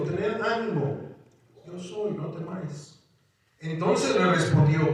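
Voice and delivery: reading the passage aloud with a lift; preacher reads the storm passage to the church, lifted reading voice; scripture reading. Acted.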